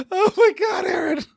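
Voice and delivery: high laughing "oh my God"; giggly disbelief; laughing exclamation. Real, not acted. Still laughing at joke.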